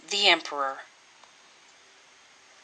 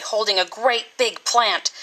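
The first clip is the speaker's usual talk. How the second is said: ordinary delivery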